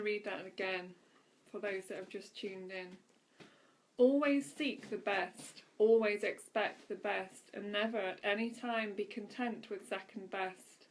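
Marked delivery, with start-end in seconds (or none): 4.0-10.9 louder, emphatic inspirational reading; devotional reading. Acted. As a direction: read each line as an uplifting message to the listeners. Rereads the passage for newcomers.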